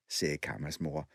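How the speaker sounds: usual speaking voice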